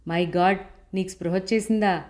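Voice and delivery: usual speaking voice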